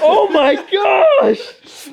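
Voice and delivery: high, slow laughing scream; shocked laughing shout. Real, not acted. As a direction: stretch it out in shock. Finds a huge moth.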